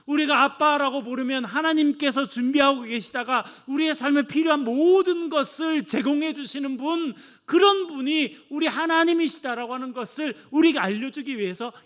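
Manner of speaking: fervent preaching. Acted. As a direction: preach it to the congregation with conviction. Preaching at full force. (뜨겁게 선포하는 설교)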